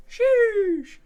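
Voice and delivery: high, loud mock sheesh; impressed sheesh. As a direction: squeal it like an impressed girl. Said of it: "she'd be like".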